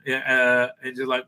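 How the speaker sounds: ordinary delivery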